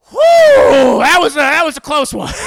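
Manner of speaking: high, loud, fast breathless relief; relieved exhale. Retold or imitated. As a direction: blow out the tension, barely escaped. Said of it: "And Dio's like".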